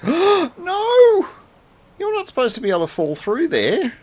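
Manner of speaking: gasp, then a loud high shocked cry; panicked outburst as it falls. Real, not acted. Chicken falls through floor.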